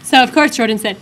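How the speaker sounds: normal talking voice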